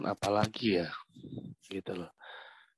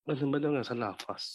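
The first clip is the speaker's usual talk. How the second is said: ordinary talk, nothing marked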